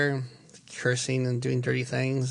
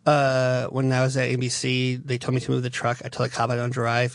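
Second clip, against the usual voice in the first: normal talking voice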